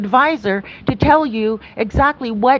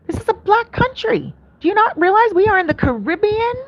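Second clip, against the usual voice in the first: much higher, indignant disbelief; incredulous retort; 10 semitones higher than usual. Retold or imitated. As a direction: shoot back at him in high-pitched disbelief. Retold argument with the man.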